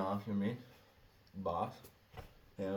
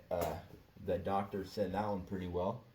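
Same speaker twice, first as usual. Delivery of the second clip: usual speaking voice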